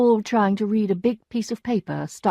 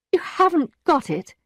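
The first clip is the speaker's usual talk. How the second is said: stunned; 9 semitones higher than usual. A narrator's reading. Stunned echo.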